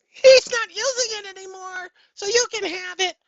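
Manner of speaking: high, loud squeaky voice of the video's originator; high put-on voice. Ben Lowry's voice granting the phrase to the vlogger, high and squeaky. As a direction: chirp it out high as the generous Brit. Imagined British creator granting permission.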